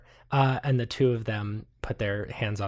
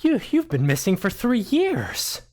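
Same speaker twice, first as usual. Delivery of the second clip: higher, louder nervous journalist; stammering, a bit higher; lightly voiced nervous NPC; 9 semitones higher, 4 dB louder than usual. Journalists nervously telling her she's been missing.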